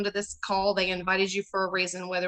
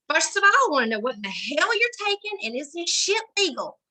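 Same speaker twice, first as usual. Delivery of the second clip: high-pitched prim Southern lady, scandalised; older Southern lady; 8 semitones higher, 4 dB louder than usual. Retold or imitated. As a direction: play a sweet older Southern woman, shocked. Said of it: "she's like".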